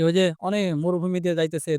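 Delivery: ordinary talk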